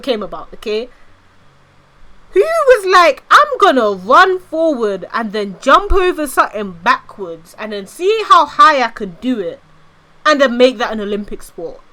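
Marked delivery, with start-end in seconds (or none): 2.3-11.8 incredulous rant. Real, not acted. Streamer ranting to chat about Olympic events, incredulous. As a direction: rant in amused disbelief.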